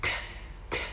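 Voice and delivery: t puffed out with air; aspirated t. Shown as a demonstration. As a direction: blow a soft breathy t. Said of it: "o T tem som de t".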